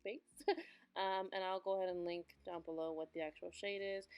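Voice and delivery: soft, even tutorial voiceover; voiceover mode. Acted. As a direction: narrate the steps calmly to camera. Voiceover narration of makeup.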